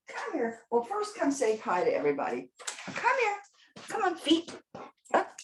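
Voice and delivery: sing-song high coaxing to a pet; coaxing her dog with high calls. Real, not acted.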